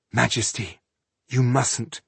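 usual speaking voice

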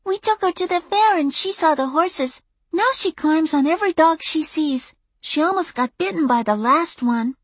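put-on high-pitched child explaining; high, matter-of-fact kid voice; six-year-old girl. A narrator's reading. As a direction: tattle earnestly as a little girl. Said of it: "told me".

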